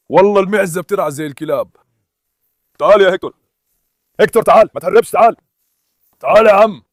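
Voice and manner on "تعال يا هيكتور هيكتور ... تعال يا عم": louder, higher calls across the garden; calling the dog. Real, not acted. Owner calling his dog Hector across the garden, loud calls. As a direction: call him back before he runs off.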